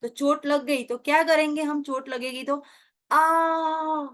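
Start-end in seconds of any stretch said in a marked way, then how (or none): 3.1-4.1 short pained "aah" cry; groan of pain.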